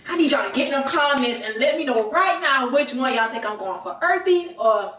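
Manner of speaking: loud, emphatic order to the followers; demanding demo. Shown as a demonstration. Friend showing her what to tell followers, loud and bossy. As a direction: belt it out at the audience. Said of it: "tell 'em".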